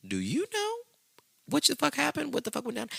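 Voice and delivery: high-pitched mock bewilderment; retold disbelief. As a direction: demand answers in a high, incredulous voice. Husband confronts wife after possession.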